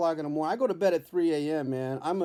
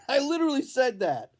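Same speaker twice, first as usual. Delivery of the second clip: laughing through the words, pitched higher; 10 semitones higher, 4 dB louder than usual. Real, not acted. Comedian laughs while telling viewers what he said, real laugh. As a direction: crack up at your own line.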